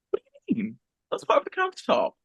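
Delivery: high innocent voice pretending it's nothing; mock innocence. Feigned innocence to manager.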